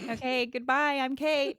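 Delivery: high, loud silly farewell; mock sign-off. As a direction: put on a high-pitched goodbye. Answers with mock sign-off, called terrible.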